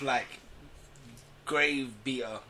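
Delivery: ordinary talk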